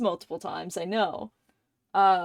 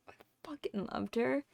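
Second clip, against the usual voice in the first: tearful hush; 3 semitones higher, 9 dB quieter than usual. Real, not acted. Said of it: "now I'm gonna cry".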